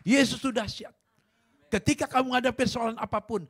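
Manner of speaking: loud, high fervent sermon; fervent pulpit exhortation; full-force preaching. Acted. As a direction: preach it out to the congregation.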